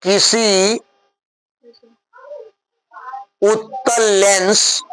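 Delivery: slow, spaced dictation for writing; a teacher dictating the question slowly. Shown as a demonstration. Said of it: "प्रश्न लिखिए".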